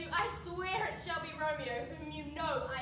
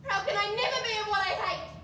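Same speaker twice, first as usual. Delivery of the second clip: defiant outcry; 3 semitones higher, 11 dB louder than usual. Acted. Daughter defies father.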